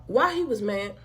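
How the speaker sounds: normal talking voice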